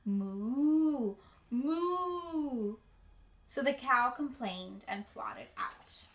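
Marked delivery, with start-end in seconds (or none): 0.1-2.8 long drawn-out moo, moo; cow mooing. Acted. Librarian hears only the cow's long moo. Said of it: "All she heard was".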